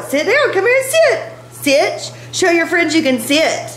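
high-pitched pet commands; squeaky, encouraging dog talk; coaxing a dog. Real, not acted. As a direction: coax the dog in a high, cutesy voice. Coaxing her dog to sit.

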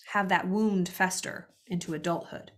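ordinary talk

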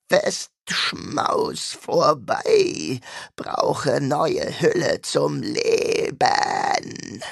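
very slow, dragged-out alien demon voice; a creature forming words it barely knows. A narrator's reading. Demon in Carlos's body announces it needs a new host, slow and otherworldly. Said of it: "zischte der Dämon".

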